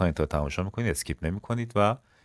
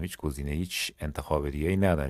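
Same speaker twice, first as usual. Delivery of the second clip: usual speaking voice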